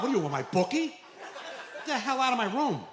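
laughing fake fury; mock outrage. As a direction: throw him out in comic disbelief. Dad yelling at son.

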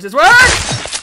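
high, loud rising cheer; triumphant shout. Real, not acted. Gamer cheering his own jump, triumphant shout. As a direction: yell it as it finally works.

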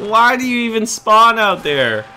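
loud, high, slow complaint; frustrated. Real, not acted. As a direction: rant at the game. Complaining about spawns.

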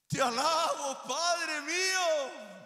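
loud, high, very slow praise cry. Acted. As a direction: cry out to the Father with all you have. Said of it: "Ese es ese clamor".

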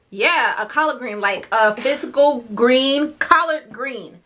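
patiently spelled out, slow; slow explaining. Retold or imitated. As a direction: explain it slowly like to a child. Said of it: "I'm like".

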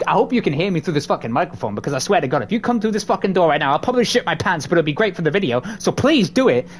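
ordinary talk, nothing marked

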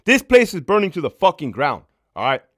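loud, high exasperated outburst; angry. Retold or imitated. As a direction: let the frustration burst out. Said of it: "I just woke up my wife".

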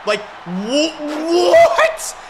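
shrill, loud laughing what?; disbelieving what. Real, not acted. Gamer stunned by a penalty, squealing a loud laughing what. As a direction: crack up in disbelief at the ref. Shocked by the game's call.

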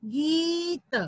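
गीत with a long, held ee; long vowel. Shown as a demonstration. Teacher answering which word was made, drawn-out गीत. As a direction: stretch the vowel before the त in गीत. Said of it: "कौन सा शब्द बना?".

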